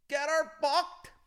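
high, slow, drawn-out yell; imitated street yell; screaming children. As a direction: scream it like kids cycling past. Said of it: "past each other screaming".

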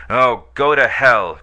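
bartender's furious brush-off; furious cry. A narrator's reading. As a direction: shout her away before slamming the door. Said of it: "cried he".

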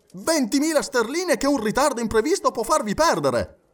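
high-pitched, urgent warning; alarmed club member. Acted. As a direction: warn him with mounting agitation. (avvertimento allarmato)

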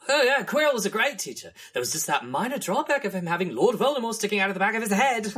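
mock-cheerful praise with a sneer; Harry sassing Umbridge, sarcastic and high; sarcastic quote.

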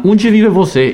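mock Brazilian Portuguese lilt; lightly put-on Brazilian accent. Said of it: "poniendo acento".

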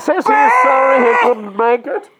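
high, strained mock Bane voice. Greeting the other Banes in a hammed-up high Bane voice. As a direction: ham up a squeaky Bane.

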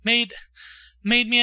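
high, bursting, broken-off phrases; excited exclamation. A narrator's reading. Daughter running to her mother with news, excited exclamation. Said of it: "she exclaimed".

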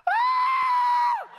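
very high, loud imitated toddler scream; imitated child scream. Dad imitating Nora screaming with her hand in the freezer door, loud high shriek. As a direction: let out the toddler's shriek, hammed up.

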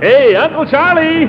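high, loud hail across the pump house; calling out. Real, not acted. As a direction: shout for Charlie over the racket. Calling out over machine noise.

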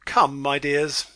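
a lord gathering his family, dignified; wrapped in dignity. Acted. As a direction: withdraw with injured dignity. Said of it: "Wrapping himself in his dignity".

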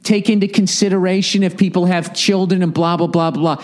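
high whiny mock objection; lightly voiced know-it-all audience; mocking the listeners. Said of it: "I know you guys are gonna say".

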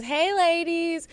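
high, slow letter greeting; letter reading voice. Acted. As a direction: read it out sweetly. Reads listener letter.

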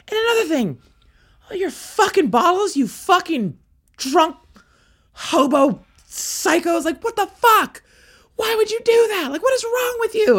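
high-pitched tirade, hitting each insult; re-enacted outburst, high and indignant; retold screaming rant. Daughter screaming at dad and new wife, high angry rant. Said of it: "I just was screaming whatever I could at them".

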